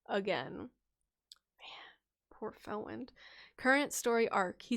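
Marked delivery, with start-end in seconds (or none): 1.6-3.1 slow whispered pity. Real, not acted. Streamer pities the viewer's character, slow whisper. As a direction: breathe it out in sympathy. Pitying aside.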